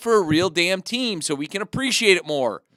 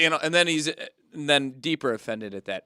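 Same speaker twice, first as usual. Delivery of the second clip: normal talking voice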